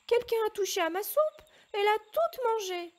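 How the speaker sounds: high, loud squeaky little bear cry; tiny bear's little voice. A narrator's reading. Little bear crying to his family over his eaten soup, high and loud. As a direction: wail it like a tiny bear cub. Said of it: "cria le tout petit ours de sa toute petite voix".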